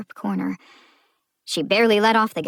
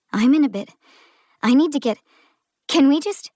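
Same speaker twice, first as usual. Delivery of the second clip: strained politeness, rising higher and louder; through clenched teeth; 7 semitones higher, 5 dB louder, faster than usual. Acted. Driver pleading with the cop through clenched teeth. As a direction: smile while your patience snaps. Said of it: "smiled through clenched teeth".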